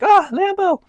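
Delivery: put-on high, loud kid-like gasp of delight; put-on squeal of awe; imagined childhood amazement. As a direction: mimic an eight-year-old spotting a dream car. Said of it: "I'd have that reaction like".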